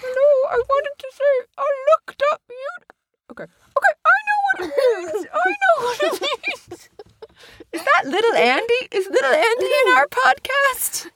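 high squeaky puppet voice, far above her own; little Andy voice. Pretend. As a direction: play a tiny toy character.

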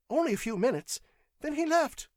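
high-pitched taut nerves; jittery clerk under questioning; shrill, nervous clerk. A narrator's reading. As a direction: answer in a high, anxious voice. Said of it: "The clerk's voice was shrill".

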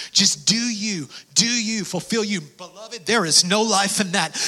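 ordinary talk

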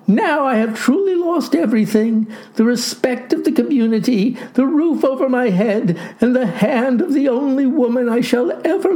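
high-pitched, tearful lament; weeping. A narrator's reading. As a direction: bewail it pitifully as a broken lad. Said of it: "wept the lad".

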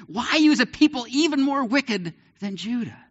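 high, incredulous question; indignant protest. Retold or imitated. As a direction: protest the unfairness. Habakkuk protests God's choice.

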